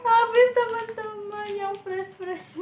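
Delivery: bright excited announcement. Real, not acted. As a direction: tell them it's all gone.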